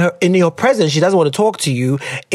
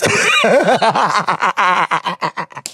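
laughing. Real, not acted. Laughing at own tangent.

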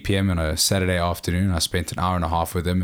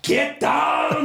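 Arnold impression. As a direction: yell it like an action hero. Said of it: "Arnold, like".

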